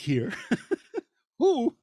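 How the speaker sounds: words broken by a laugh; laughing while ribbing a colleague. Real, not acted. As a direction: giggle through the teasing. Teasing Nate about his scripts.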